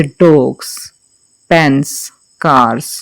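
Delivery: "डॉग्स, पेन्स, कार्स" ending in a buzzing z; dogs with z ending. Shown as a demonstration. Teacher models plural endings, buzzing z sound. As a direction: end "डॉग्स" on a voiced z, not s. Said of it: "हमने उसे जा पढ़ना है".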